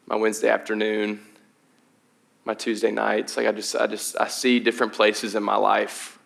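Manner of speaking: soft, hushed prayer voice; quiet prayer. Acted. Pastor praying over the church family, quiet and earnest. Pastor prays before the sermon.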